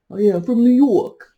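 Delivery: the Massachusetts boyfriend faked with a New York accent. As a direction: play a brash guy with a New York accent. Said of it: "so let's change it to New York".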